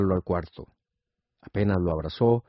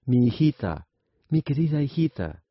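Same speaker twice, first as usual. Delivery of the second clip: higher-pitched acted cry for a lost child; mild anguished cry; 6 semitones higher than usual. A narrator's reading. Poor Midas cries out for his daughter turned gold, mildly anguished. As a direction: call out in mild, narrated anguish, without extra volume. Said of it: "gritó el pobre Midas".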